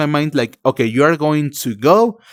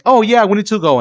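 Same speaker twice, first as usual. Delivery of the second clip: higher, louder hurried interruption; friend cutting in; 8 semitones higher, 5 dB louder than usual. Retold or imitated. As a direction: cut in to drag him off. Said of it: "be like".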